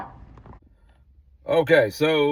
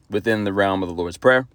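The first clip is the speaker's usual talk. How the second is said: ordinary talk, nothing marked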